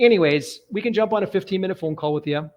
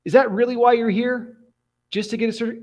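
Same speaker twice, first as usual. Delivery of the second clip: incredulous officer; 7 semitones higher, 4 dB louder than usual. Retold or imitated. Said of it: "He's like".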